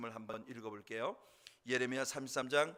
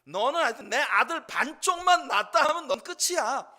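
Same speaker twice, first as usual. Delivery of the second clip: high, loud hammed-up threat; spiteful threat; 12 semitones higher, 13 dB louder than usual. Hannah threatening her rival, loud mock spite. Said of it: "분인나에 대한 말할 수 없는 원망과 미움이".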